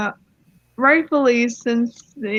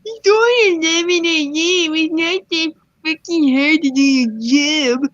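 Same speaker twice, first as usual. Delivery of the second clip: barked back; 4 semitones higher than usual. Retold or imitated. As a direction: retell their snappy comeback with some bite. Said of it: "barked back".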